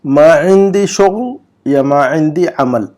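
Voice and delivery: slow, syllable by syllable Arabic; slow model reading. Shown as a demonstration. Teacher reads the Arabic example slowly for students. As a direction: read it out slowly for students to copy. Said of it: "number گیارہ کا جملہ پڑھیے".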